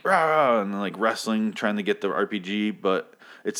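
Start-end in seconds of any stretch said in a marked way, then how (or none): 0.0-0.6 mock roar, higher and louder; imitated struggling roar. Fan acting out Abraham wrestling the zombie, mock roar. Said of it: "really weird shot of him, like".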